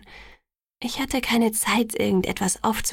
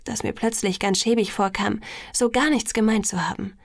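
ordinary delivery

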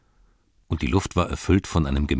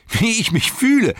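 much higher, loud joyful call; laughing shout; 6 dB louder than usual. Acted. Sam leaps from the bed, laughing, high joyful shout. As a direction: shout it out high with pure joy. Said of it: "rief er".

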